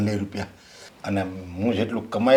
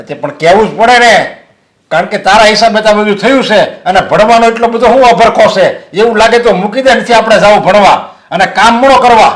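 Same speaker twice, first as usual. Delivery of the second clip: higher and louder, hurling accusations; angry shouting; 11 semitones higher, 15 dB louder than usual. Acted. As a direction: rant at her, blaming everything on her. Father shouts she must quit studies.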